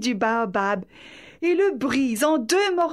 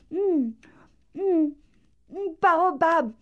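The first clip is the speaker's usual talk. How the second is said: savouring food; 6 semitones higher than usual. Acted. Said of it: "savoure la feuille lentement".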